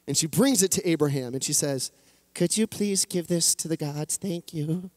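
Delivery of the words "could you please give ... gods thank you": a lightly voiced old woman, polite and fading soft; hint of a frail old lady asking a favour. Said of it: "I don't know why I made the old lady's voice like that".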